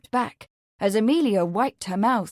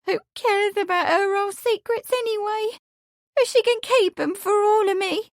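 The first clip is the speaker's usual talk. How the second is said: put-on very high, petulant wail of a hurt girl; tearful outburst; 12 semitones higher, 3 dB louder than usual. A narrator's reading. Amelia milks her hurt to Lawrence, tearful outburst. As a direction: whine it high as if through sobs. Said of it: "She gave another sob".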